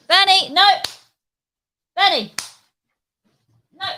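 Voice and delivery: loud, high call of no; sharp call to stop the dog; scolding the dog. Real, not acted. Telling the dog Bernie off, loud and high.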